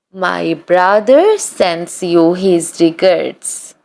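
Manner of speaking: normal talking voice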